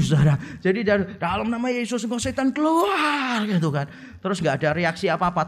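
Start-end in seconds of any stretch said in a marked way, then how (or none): 1.1-3.5 imitated exorcist cry. Friend driving out a demon, imitated high cry. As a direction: imitate a yelling exorcist without full volume. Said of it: "teriaknya kencangnya setengah mati".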